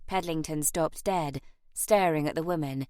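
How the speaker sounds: plain reading voice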